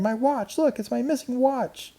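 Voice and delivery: put-on high delighted girl voice; thrilled to find it; joyful girl. A narrator's reading. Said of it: "cried Karen".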